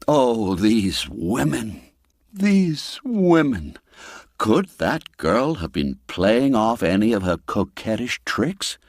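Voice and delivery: plain reading voice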